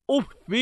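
أف ending in short "i", "uffi"; kasra reading. Shown as a demonstration. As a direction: say أف carefully as "uf-fi". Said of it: "جعله بمنزلة مد يدك".

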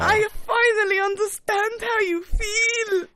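high, drawn-out triumphant cry; slow, high-pitched crowing; gleeful gloating. Real, not acted. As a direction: relish the win in a squeal. Gloating as co-host fails the riddle.

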